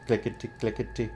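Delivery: quiet, quick sound-effect voice; soft rattling sound. A narrator's reading. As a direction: hush it down to a tiny rattle. Coins rattling in tin box.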